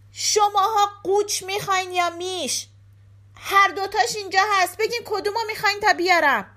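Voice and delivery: high, insistent shout. A narrator's reading. Giske asks the thieves which sheep they want, shouting. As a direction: call out loudly, pressing for an answer. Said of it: "داد زد".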